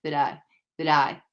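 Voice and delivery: usual speaking voice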